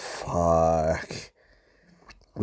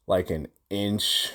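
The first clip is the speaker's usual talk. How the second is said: ordinary talk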